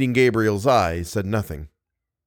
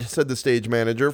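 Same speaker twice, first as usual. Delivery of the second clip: ordinary delivery, nothing marked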